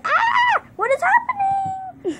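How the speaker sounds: high, drawn-out shocked cry; shocked shriek. Real, not acted. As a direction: shriek it in disbelief. Confused by game glitch.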